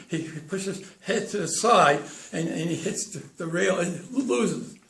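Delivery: halting, tripping on first sounds; acted stutter. Charlie tells the trainer and owner about the horse, acted stutter. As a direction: stutter your way through a complaint.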